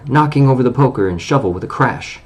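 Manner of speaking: usual speaking voice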